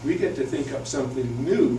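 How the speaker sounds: ordinary talk, nothing marked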